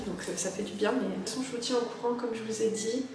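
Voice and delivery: speaking softly in a waiting room; hushed. Real, not acted. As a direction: keep it hushed, nervous. Whispering in the dentist's waiting room.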